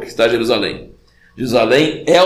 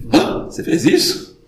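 startled "huh?" and higher question; mock surprise. God surprised by what someone did, acted astonishment. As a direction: play astonished disbelief, voice jumping up. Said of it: "até Deus fica surpreso comigo".

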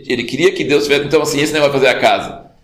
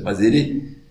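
ordinary delivery

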